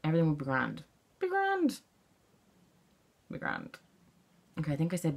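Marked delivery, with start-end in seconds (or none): 1.2-1.8 high-pitched catchphrase. Shown as a demonstration. Playing with the repeated catchphrase. 3.3-3.8 very low, soft "Be grand"; deep-voiced catchphrase. Shown as a demonstration. Same catchphrase, dropped very low.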